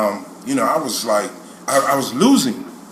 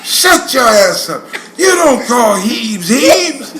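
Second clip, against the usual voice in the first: loud, slow mock-furious dad, pitched up; scolding father; 4 semitones higher, 4 dB louder, slower than usual. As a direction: chew out your son as an exasperated father. Father scolding son on phone, retold.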